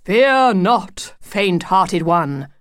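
slow, lordly, mock-heroic proclamation; grand heroic wolf. Wolf leaping in to reassure the worried family, mock-heroic. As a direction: announce yourself like a gallant knight.

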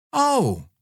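very high small oh; little girl's surprised oh. Acted. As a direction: realise you got it wrong. Child deflated.

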